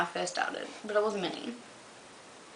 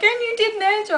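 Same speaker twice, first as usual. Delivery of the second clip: mock friend voice; 12 semitones higher, 4 dB louder than usual. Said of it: "she's like".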